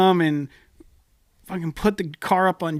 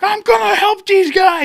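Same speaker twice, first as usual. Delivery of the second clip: much higher, louder, slow mock yell; yelling at lady; 7 dB louder, slower than usual. Stranger yells at the lady inside his trailer, high loud mock yell. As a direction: bellow it through the trailer wall. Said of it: "he just starts yelling at some lady".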